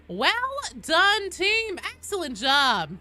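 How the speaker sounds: high, loud team praise; congratulating the team. Real, not acted. Streamer congratulates the team after a match, high excited call.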